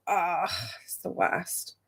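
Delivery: soft, slow groan; sympathetic groan. Real, not acted. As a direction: commiserate heavily.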